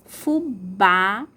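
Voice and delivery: "Fubá" with a loud, long BÁ; "Fubá" in two beats, open strong á at the end; stressed final syllable. Shown as a demonstration. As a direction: punch the last syllable of "Fubá". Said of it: "a sílaba tônica é o bá".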